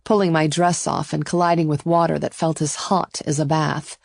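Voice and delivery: plain reading voice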